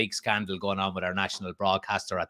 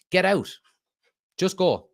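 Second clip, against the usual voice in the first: fed-up demand; 7 semitones higher, 4 dB louder than usual. Real, not acted. Telling the Glazers to leave.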